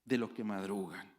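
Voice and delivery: thundering preacher's lament; proclaimed prophetic woe. Acted. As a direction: cry out the prophet's lament to the congregation. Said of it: "Isaías dice".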